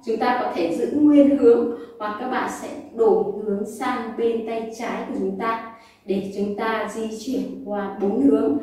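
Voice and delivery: ordinary talk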